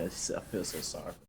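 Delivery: barely audible pity; whisper. Real, not acted. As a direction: breathe it out in pity. Sympathetic aside.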